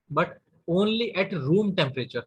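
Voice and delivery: slow, stressed repeat of the key phrase; slow down on the key point; slow emphasis. Shown as a demonstration. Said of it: "repeats key phrase slowly". (धीमे ज़ोर देकर)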